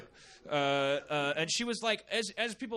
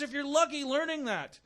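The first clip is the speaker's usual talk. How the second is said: ordinary delivery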